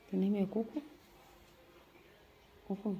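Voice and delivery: hushed goodnight to a sleeping pet bird; hushed so she sleeps. Real, not acted. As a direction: speak low so the sleeping bird won't wake. Bird asleep, speaker hushes.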